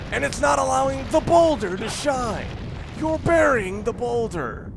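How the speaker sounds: high, loud, slow hammy bellow; booming wrestler-style impression. Game player quoting a little reference about the boulder, bellowing hammily. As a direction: boast in a big put-on showman's voice. Acts out a quoted reference character.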